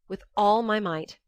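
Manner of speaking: usual speaking voice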